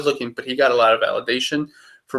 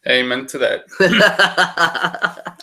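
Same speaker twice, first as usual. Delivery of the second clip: laughing; 4 semitones higher, much slower than usual. Real, not acted. Laughing agreement.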